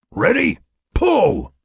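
high rising check, then loud falling command; commanding shout. A narrator's reading. Mac and Laura haul on Frank's tether, commanding shout. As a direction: shout it to set off a joint heave. Counting in a team haul on rope.

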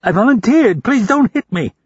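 begging the men to stop hitting; frightened begging. A narrator's reading. Beaten captive begs.

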